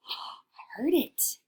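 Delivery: gasp, then a whispered "I heard it"; excited whisper. Pretend. Teacher thrilled at hearing the sound, whispered.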